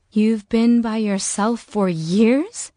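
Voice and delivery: slow, wondering question. Acted. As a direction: marvel at it slowly. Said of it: "Juliet said in wonder".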